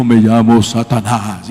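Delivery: low, slow put-on demon voice; possessed man's voice. Preacher imitates a possessed woman answering, deep man's voice. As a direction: drop the voice deep and drag it out. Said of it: "empostó la voz y habló con voz de hombre".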